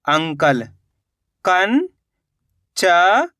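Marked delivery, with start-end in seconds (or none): 1.4-3.3 drawn out very slowly, sound by sound; slowly stretched syllable. Shown as a demonstration. Teacher to pupils, sounding out a word very slowly. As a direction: stretch each sound for the class. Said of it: "तो मिलके बन जाएगा कंचन".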